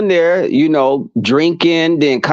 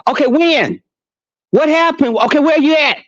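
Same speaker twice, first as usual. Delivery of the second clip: higher, urgent mock concern; acting alarmed for the friend's rescue call; fake alarm; 7 semitones higher than usual. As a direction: play up the fake emergency call.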